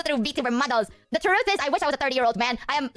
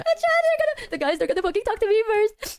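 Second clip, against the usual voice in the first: high panicked whine; 10 semitones higher than usual. Real, not acted. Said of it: "I don't wanna be that person".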